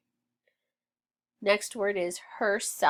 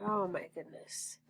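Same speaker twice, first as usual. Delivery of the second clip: whispered aside; 9 dB quieter than usual. Real, not acted. Frustrated while writing on board.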